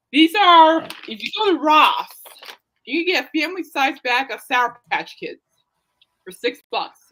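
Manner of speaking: ordinary delivery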